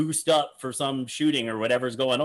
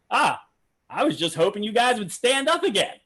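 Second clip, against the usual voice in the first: higher mock voice hoping for another ovation; a raised, wheedling send-up of the magician; imitating Mac; 8 semitones higher, 4 dB louder than usual. Commentator mimics the magician angling for another ovation, higher playful mock voice. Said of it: "he goes".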